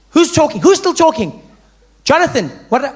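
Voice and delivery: high, loud classroom challenge; angry teacher. Acted. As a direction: call out the troublemaker sharply. Teacher scolds Jonathan.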